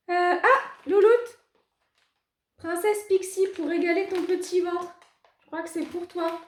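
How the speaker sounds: high, loud doggy talk. Real, not acted. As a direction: coo at the pet. Talking to her dog.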